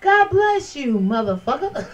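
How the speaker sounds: high singsong God bless you; sing-song blessing to a troll; sassy mock blessing. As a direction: bless them sweetly, then curse. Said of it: "I was like on some shit like".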